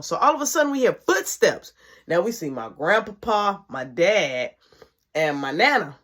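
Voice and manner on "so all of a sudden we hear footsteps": ordinary talk, nothing marked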